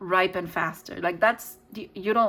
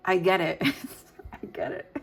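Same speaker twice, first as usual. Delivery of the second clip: amused, laughter breaking into the words; laughing. Real, not acted. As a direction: laugh in rueful recognition. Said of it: "I'm laughing because".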